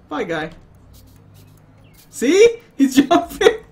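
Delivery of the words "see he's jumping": high laughing excitement; giggling through the words. Real, not acted. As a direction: burst out laughing at the screen. Laughing at game physics.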